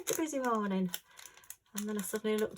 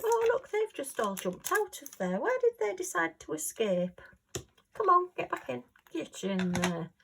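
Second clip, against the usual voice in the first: high, mock-cross telling-off of naughty drills; startled scolding; 8 semitones higher than usual. Real, not acted. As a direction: shoo the escaped drills back in, pitched way up.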